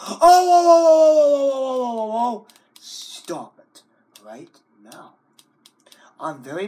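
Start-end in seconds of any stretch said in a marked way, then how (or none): 0.1-2.5 loud high stretched whoas; mock alarm. Dramatic alarm at screen. 2.8-5.1 whispered warning of Stop it right now; whispered threat. Acted. Threatening the computer.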